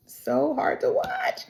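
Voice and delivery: high, slow mock whine; pained squeak. Reacting to the hard episode, high pained whine. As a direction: draw it out high, as if it hurts.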